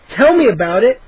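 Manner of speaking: ordinary delivery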